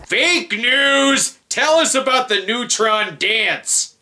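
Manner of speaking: high, jeering call at the screen; mocking heckle. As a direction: heckle the anchor. Heckling the news anchor.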